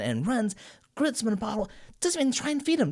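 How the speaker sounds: ordinary talk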